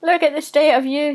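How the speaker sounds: high, loud jeering Bishop voice; mocking Bishop. A narrator's reading. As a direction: jeer at him as the laughing Bishop. Laughing Bishop mocks Mister Testy.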